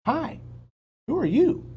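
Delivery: high, loud friendly call. Acted. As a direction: call up to the creature. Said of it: "Annie called to the pteranodon".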